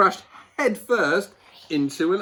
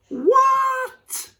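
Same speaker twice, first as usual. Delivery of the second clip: very high startled WHAT; shocked animals; 6 dB louder than usual. A narrator's reading. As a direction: gasp it in disbelief. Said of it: "said all the other animals".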